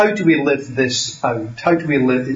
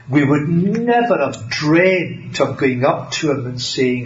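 ordinary talk, nothing marked

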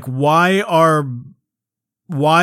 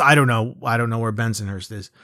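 usual speaking voice

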